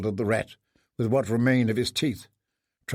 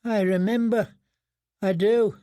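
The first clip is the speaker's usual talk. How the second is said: tearful; 7 semitones higher than usual. Acted. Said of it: "Tears ran down his cheeks".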